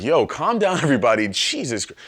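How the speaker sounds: high, loud hyped call to the crowd; calm the roaring crowd, hammed-up; mock excited Michelle. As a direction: Michelle Obama shouting over the shaking stadium. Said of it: "she was like".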